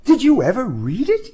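a hint of a young woman; woman's voice. A narrator's reading. Woman's line in argument.

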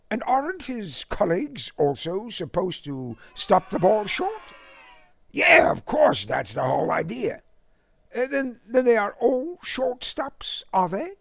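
politely amazed, eager to learn; English gentleman. A narrator's reading. Bland marvels to Jack about the film star, prim and high. Bland puzzles over baseball rules.

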